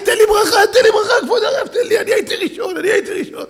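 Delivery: high, loud eager pleading; loud excited begging; clamouring officers. Retold or imitated. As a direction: jostle to be blessed first. Jailers clamour for blessing.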